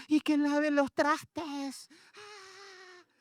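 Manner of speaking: put-on high, drawn-out child's whine; whiny child. Preacher as boy answering his mother, whiny child. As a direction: imitate a child answering in a whimper. Voices himself as whimpering child.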